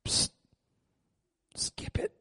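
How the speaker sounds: unvoiced whisper to the pastor. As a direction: hiss it secretly at the pastor. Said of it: "groom-to-be said this".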